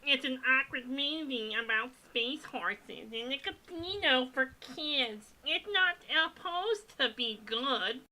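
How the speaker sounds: high, odd goblin voice, second attempt; goblin voice retry. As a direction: try the goblin voice again, nasal and weird. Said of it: "What's the goblin voice?".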